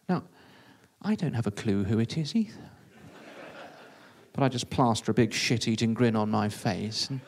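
ordinary delivery

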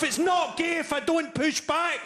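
hammed-up soldier's resolve; mock shout. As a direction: belt it like a battle vow. Soldier's inner quote, shouted.

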